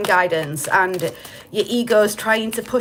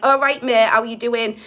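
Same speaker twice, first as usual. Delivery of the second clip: loud, matey Hull council-estate greeting; broad Hull estate accent; 4 dB louder than usual. Retold or imitated. Doing his higher self as a Hull estate local. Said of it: "The voice of my higher self sounds like".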